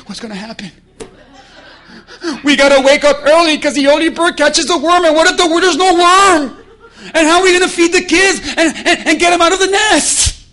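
high, loud, laughing panic of a worried bird; mock fretting bird. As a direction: play the anxious bird, half laughing.

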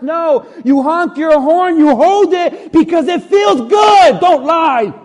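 high, loud, building outburst; heated preaching. Real, not acted. Preacher calling out drivers who honk, loud and heated. Acting out road-rage honking.

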